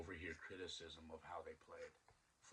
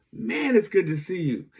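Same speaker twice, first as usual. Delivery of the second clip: happy reunion; 13 semitones higher, 25 dB louder than usual. Retold or imitated. Reunion hug.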